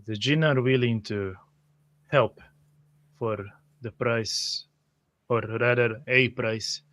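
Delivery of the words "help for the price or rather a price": usual speaking voice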